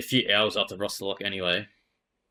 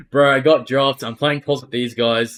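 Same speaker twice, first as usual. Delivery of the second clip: raised, louder, fired-up reply to a mate; excited retold reply; 2 semitones higher, 8 dB louder than usual. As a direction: blurt it out like big news to your mate. Retelling his reply to Mitch.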